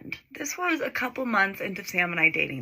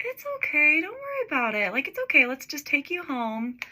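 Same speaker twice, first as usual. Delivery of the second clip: put-on higher, gently soothing boyfriend voice; imitating reassuring boyfriend; 6 semitones higher than usual. Boyfriend calming his crying girlfriend, higher soothing voice. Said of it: "He was like".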